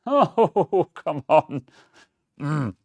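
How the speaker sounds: high, loud frustrated groan; real annoyed outcry. Real, not acted. Solder not sticking again.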